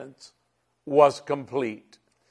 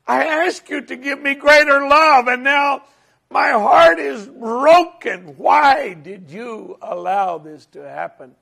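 anguished, much higher and louder outcry; crying out to God; 9 semitones higher, 6 dB louder than usual. Retold or imitated. Pastor crying out to God while driving, anguished high shout. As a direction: retell a desperate cry to heaven. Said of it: "And I'm crying out to God".

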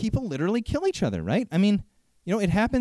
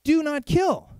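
mock Pharisee rule; 8 semitones higher, 3 dB louder, slower than usual. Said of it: "they're like".

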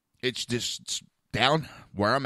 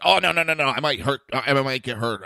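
louder, hammed-up no no no; mocking refusal; 4 semitones higher, 6 dB louder than usual. Firemen asked to risk themselves, a mock panicked refusal. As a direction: mimic firemen backing off fast.

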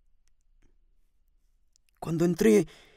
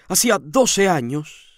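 ordinary delivery

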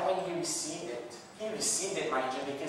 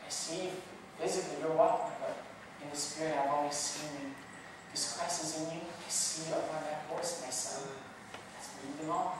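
much softer, gentle and encouraging; calm soothing God; 10 dB quieter than usual. Acted. God encouraging Ben, much softer and soothing. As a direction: speak softly like a loving father. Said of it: "calm, soothing voice".

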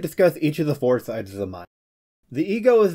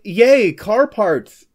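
slow, loud delighted cheer; excited cheer; 8 semitones higher, 8 dB louder, much slower than usual. Retold or imitated. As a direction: gush with childlike glee. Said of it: "they're suddenly like".

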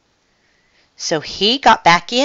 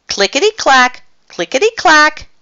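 lightly voiced train chant, pitched up; playing trains; 10 semitones higher, 4 dB louder than usual. A narrator's reading. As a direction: chant it playfully like kittens. Kittens pretending to be a train.

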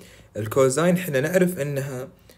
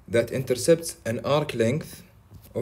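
slow reading; 2 semitones higher, much slower than usual. Shown as a demonstration. Reading the question word by word.

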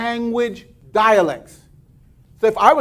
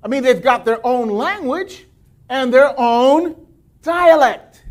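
ordinary talk, nothing marked

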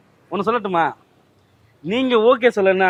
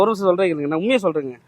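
normal talking voice

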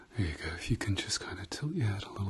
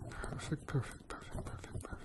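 whispering; 7 semitones higher, 9 dB quieter than usual. Acted. Repeated soft praise.

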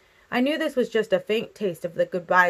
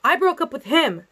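high-pitched, insistent retort; shouting back; 8 semitones higher, 4 dB louder than usual. A narrator's reading. Girl answering her father through the door, shouting. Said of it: "I shouted back".

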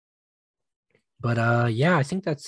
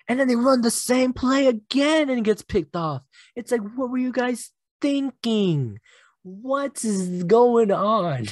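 much higher, squeaky disbelief, slowing down at the end; can't believe what they did; incredulous exasperation; 11 semitones higher than usual. Real, not acted. Exasperated at repeated interception.

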